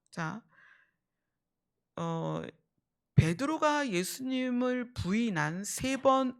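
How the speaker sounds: ordinary delivery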